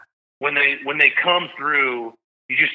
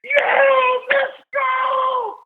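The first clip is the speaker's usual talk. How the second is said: screaming with joy; much slower than usual. Retold or imitated. Teen son in dad's arms screaming with joy, very high. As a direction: scream it right into dad's face. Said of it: "starts screaming in my face".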